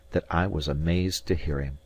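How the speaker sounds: ordinary delivery, nothing marked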